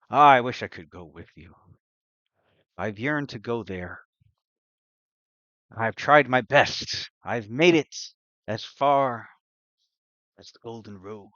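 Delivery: loud, declaimed in-character yearning; boastful adventurer figure. Acted. LaRussa telling the party of failed journeys, loud and theatrical. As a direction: proclaim the effort grandly, slowing on the attempts. Game master plays LaRussa.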